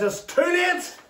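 high-pitched call, little added volume; retold calling out. Messenger telling Jairus it's over, high mild call.

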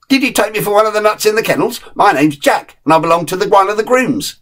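a plain dog laughing off the snobs; cheeky terrier Jack. Acted.

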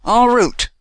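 loud, high, impatient call to move on; the old guide hurries the group along, loud and impatient; impatient cry. A narrator's reading. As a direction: urge them onward with a sharp shout. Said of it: "cried the guide impatiently".

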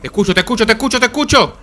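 high playful taunt; teasing singsong. Pretend. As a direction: sing it out mockingly. Taunting hidden enemy.

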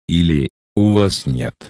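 ordinary talk

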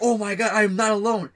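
high, loud acted relief; overjoyed relief. Worker finds he is not alone, overjoyed retold relief. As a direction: cry it out as if finally vindicated.

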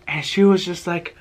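normal talking voice

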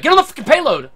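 high, loud yell at a teammate; yelling at Cindy to get on the payload. Retold or imitated. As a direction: bark the order at her. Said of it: "I'll yell".

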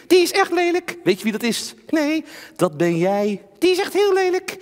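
high, scared puppet voice; nervous ventriloquist doll; talking doll. Acted. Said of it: "Henk is mijn pratende pop".

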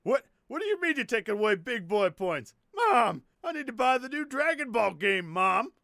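high, wailing protest; whiny tantrum. Acted. Son pleading with mom over lost points, whiny shouting. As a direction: whine and yell like a thwarted child.

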